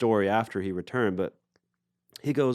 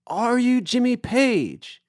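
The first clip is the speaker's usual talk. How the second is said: very high, drawn-out mock call; imitated repeated holler; 13 semitones higher, 4 dB louder, much slower than usual. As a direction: stretch every word across the water. [name] repeats call to boat.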